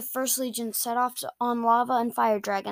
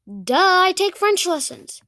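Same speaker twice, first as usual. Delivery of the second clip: raised, matter-of-course retort; duh, obviously; 7 semitones higher, 4 dB louder than usual. Acted. Boy answers his amazed friend, obvious-answer retort.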